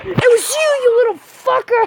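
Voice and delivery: high, heated accusing yell; angry accusation. Real, not acted. Player finding who killed him, angry high accusation.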